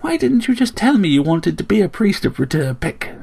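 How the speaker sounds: high-pitched scolding demand; boulder-splitting aunt. A narrator's reading. Aunt confronts nephew about the priesthood, scolding. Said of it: "The boulder splitter was back".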